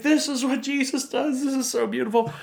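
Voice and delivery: high-pitched imitation of gushing crowd; gushing awe. Speaker voicing everybody in the church, gushing awe.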